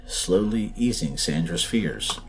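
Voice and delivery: ordinary delivery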